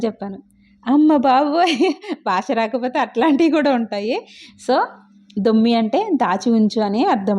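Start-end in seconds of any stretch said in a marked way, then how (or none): 0.9-1.9 slow, amazed exclamation; stretched-out amazed cry; oh my god. Real, not acted. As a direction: draw out the disbelief at her own mistake. Recalling embarrassing sandwich mix-up.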